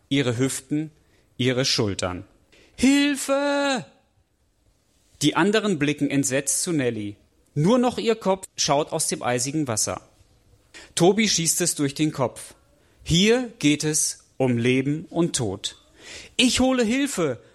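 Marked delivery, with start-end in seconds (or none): 2.7-3.8 a much higher, desperate cry for help; scream for help. Acted. Girl breaks through the ice. 16.4-17.4 much higher, urgent call; urgent shout. Acted. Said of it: "ruft er".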